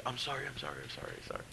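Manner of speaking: whispered, cowed apology; meek apology. Retold or imitated. The scolded rookie apologizing to the veteran, whispered. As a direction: shrink and whisper sorry.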